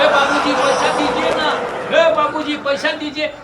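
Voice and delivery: high, loud calls for money; shouting for payment. Retold or imitated. Porter at the window yelling at the man for his money, high loud calls. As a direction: holler after the passenger for his fee. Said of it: "चिल्ला रहा था".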